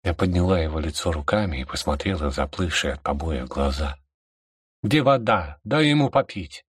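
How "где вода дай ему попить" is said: high, urgent call to the king; a higher, pleading shout. Acted. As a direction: demand it, pitched high. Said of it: "крикнула королю".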